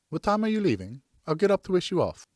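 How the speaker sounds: wife's voice. Acted.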